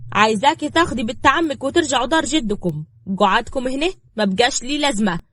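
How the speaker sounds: domineering woman. Acted. Woman ordering girl out of the house, bossy. Narrator voices Safaa.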